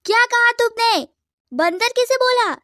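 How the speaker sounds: high, loud, outraged yell; outraged shout. Acted. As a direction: explode at being called a monkey. Insulted girl confronts her rival. (भड़ककर ऊँची चीख)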